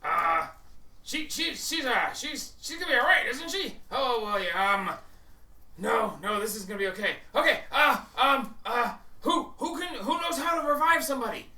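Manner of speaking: high, loud, stammering desperation; panicking companion. Acted. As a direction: plead frantically for help, tripping over words. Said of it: "He goes".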